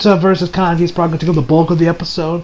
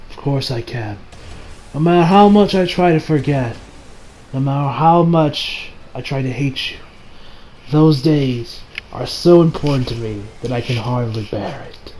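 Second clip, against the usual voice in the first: soft, low and halting, sinking at the end; pained confession; 5 semitones lower, 5 dB quieter than usual. Acted. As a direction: hold back the hurt, let it fade. Acting an emotional reply to a foe.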